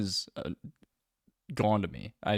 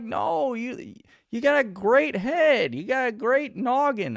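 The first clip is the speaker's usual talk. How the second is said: gushing women; 12 semitones higher than usual. Retold or imitated. Imitating women praising his head, high fawning. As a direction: imitate them overpraising. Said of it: "they were like".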